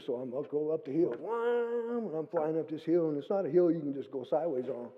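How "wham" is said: a high whoosh of wham; high sound effect. Retold or imitated. Gunning the four-wheeler up the hill, high burst. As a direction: fire off like an engine.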